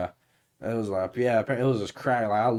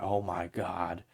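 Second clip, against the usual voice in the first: 1 hushed awe; 5 semitones lower, 9 dB quieter, much slower than usual. Retold or imitated. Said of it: "He's like".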